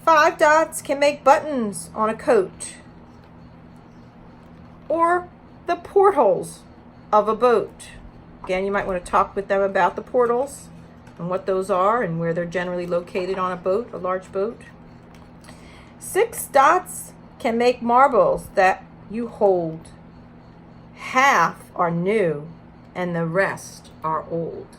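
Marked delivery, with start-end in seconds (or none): 0.0-7.9 bright, louder storybook read-aloud; reading to children. Acted. Reads picture book aloud. 16.0-24.7 put-on reading to children. Reads picture book aloud.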